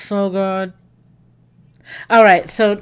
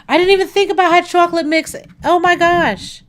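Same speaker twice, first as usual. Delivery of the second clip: excited surprise; 9 semitones higher, 5 dB louder, faster than usual. Real, not acted. Surprised by hot chocolate filling.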